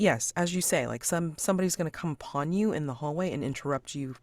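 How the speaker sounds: ordinary delivery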